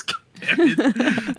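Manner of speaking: slow, laughing groan. Real, not acted. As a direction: laugh it out in defeat. Laughing at own mistake.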